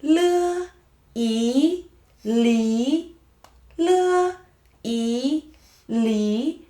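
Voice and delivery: "Le" high and level, "yi" and "li" gliding up; rising tone. Shown as a demonstration. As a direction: spell out "Le, yi, li" with the final climbing. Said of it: "The tone is rising".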